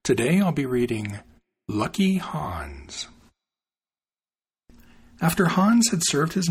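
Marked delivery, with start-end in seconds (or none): 1.7-3.1 whispered title. Acted. Title read as story opens.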